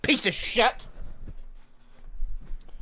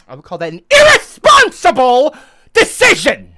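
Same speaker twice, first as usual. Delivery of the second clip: ordinary talk, nothing marked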